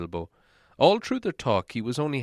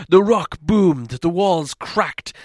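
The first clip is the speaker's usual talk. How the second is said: dramatic build; 7 semitones higher, 8 dB louder, slower than usual. A narrator's reading. Dramatic narration of the dragon's attack.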